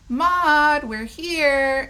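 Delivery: calling loudly into the house. A narrator's reading. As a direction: shout a greeting down the hall.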